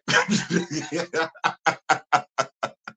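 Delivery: long, steady run of chuckles; long laugh. Real, not acted. Host refusing to take a bold claim back, long laugh. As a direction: laugh it out at length.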